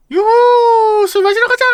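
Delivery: high, loud whooping cheer; excited whoop. Acted. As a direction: whoop it up, ready to party. Game character cheering to go drinking. (신나서 높고 크게 외치는 환호)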